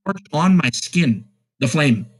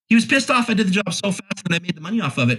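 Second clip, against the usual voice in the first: ordinary delivery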